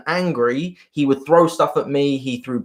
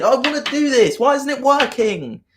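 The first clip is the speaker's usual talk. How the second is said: put-on whiny kid; 10 semitones higher than usual. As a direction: act like a kid stamping his feet. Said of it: "It turns into a kid".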